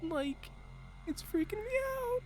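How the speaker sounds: Shaggy voice. Acted. Streamer spoofing ASMR for chat, high soft Shaggy voice. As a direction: squeak it out like Shaggy doing ASMR. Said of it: "doing A S M R with the Shaggy voice".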